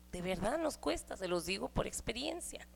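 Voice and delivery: soft confiding whisper; a breathy aside to the women. Real, not acted. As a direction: lean in and whisper it like a secret.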